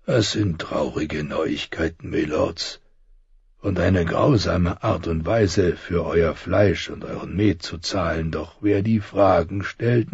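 put-on soft grim old soldier; old man voice. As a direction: softly deliver sad news as an old man. Old Joren delivers grim news.

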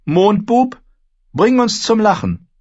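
high, loud summons; calling out. A narrator's reading. Girl calls on the fool to entertain, calling out. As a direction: call across the hall to a performer.

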